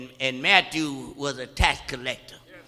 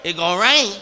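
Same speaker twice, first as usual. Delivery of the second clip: high, loud sermon cadence; full-force preaching; 7 semitones higher, 9 dB louder than usual. Acted. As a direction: preach it out to the congregation.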